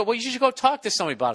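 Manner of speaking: lightly mocking wife voice; quoting his wife. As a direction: rattle off her advice in a lifted voice. Quoting wife's advice.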